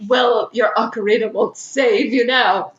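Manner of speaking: normal talking voice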